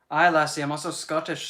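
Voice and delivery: a loud, lightly put-on Scottish brogue; playful Scottish burr, rolled and bright; Scottish accent. Streamer to chat, in a loud mock Scottish accent. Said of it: "voice lessons to actually do the accent".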